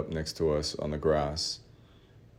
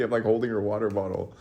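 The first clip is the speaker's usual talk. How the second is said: ordinary talk, nothing marked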